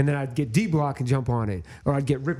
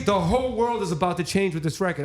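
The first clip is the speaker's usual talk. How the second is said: tag; 5 semitones higher than usual. Retold or imitated. Acts his amazed prediction.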